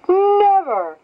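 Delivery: high, loud scornful "Never"; haughty refusal. Acted. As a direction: snub him, loud and high. Fish haughtily refuses a scale.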